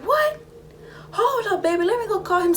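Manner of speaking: very high, startled squeal of words; high-pitched, hammed-up disbelief; imitated shocked mama. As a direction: play a mother stunned by news. His mama hearing the news.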